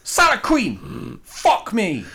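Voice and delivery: slow, high, loud mock exclamation; hammed-up roadman. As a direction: drag it out in a comic roadman outcry. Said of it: "the one who goes".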